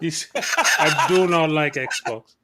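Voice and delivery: put-on slow laughing African accent; laughing mock African accent; fake African accent. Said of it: "That's, that's your fake African accent".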